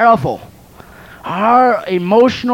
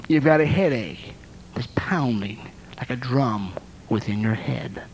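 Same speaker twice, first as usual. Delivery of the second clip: hushed; 8 semitones lower, 7 dB quieter than usual. Acted. Describing a pounding headache.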